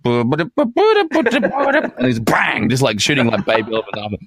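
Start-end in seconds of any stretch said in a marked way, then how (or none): 0.1-2.7 slow, high, strange mock voice; cartoon hunter hamming it up; mock gunman. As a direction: drag it out in a goofy high voice. Mimicking shooting baby elephants.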